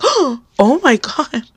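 drawn-out, high gasp of shock; gasp, then stretch it out in disbelief; stunned reaction, retold. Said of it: "I just was completely shocked".

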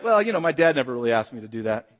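low, mumbling recruit's excuse; meek mock protest. New recruit answering the drill instructor, meek mock protest. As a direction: drop way down into a sheepish objection.

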